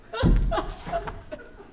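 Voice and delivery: high, panicked burst of laughter; hysterical laugh. Acted. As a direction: laugh like a woman cracking up. After dropping the bowl.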